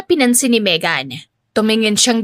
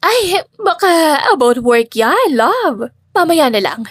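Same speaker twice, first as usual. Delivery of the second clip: slow, sugary, a little higher; sweet smiling deflection; 5 semitones higher, slower than usual. A narrator's reading. Stepmother brushing it off to her husband, sweetly smiling. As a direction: smile it away as if nothing's wrong.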